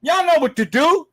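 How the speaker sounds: half-joking yell at stingy viewers to hit like; mock holler. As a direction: holler it like you're fed up calling them. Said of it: "I shouldn't have to scream and holler".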